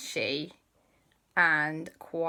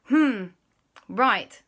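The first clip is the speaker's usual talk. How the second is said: loud, slightly raised agreement; lightly voiced Dashi; 6 semitones higher, 8 dB louder than usual. Dashi promising to try, lightly raised. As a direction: agree a bit uncertainly. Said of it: "Dashi said".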